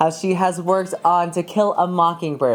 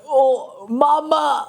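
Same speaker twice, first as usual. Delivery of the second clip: imitating; 9 semitones higher, much slower than usual. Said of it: "She was like".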